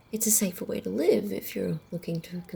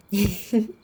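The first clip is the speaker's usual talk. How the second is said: a sudden loud, wicked laugh; short wicked laugh; 2 semitones higher, 6 dB louder than usual. Acted. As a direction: laugh at your own cruelty. Laughs at her cruelty.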